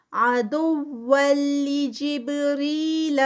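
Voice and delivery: slow, drawn-out tajweed recitation; Quranic recitation. Shown as a demonstration. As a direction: chant it slowly, stretching each sound. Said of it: "تنوین کے بعد لام ہے". (آہستہ کھینچ کر تلاوت)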